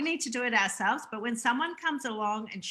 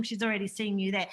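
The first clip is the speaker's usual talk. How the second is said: ordinary delivery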